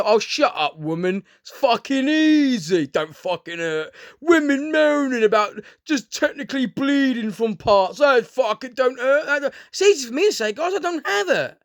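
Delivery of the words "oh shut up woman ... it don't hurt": mock dismissive man. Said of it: "easy for me to turn around and go".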